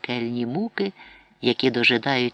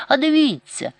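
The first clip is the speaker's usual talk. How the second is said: much higher, loud urgent call; boy urging mother to look; urgent cry; 12 semitones higher, 5 dB louder than usual. A narrator's reading. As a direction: point and shout. Boy points mother to the door.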